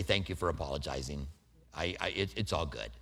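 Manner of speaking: very soft, low gracious reply; gentle thanks. Shown as a demonstration. Preacher modelling accepting an apology, very soft. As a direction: thank them tenderly. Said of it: "I can say".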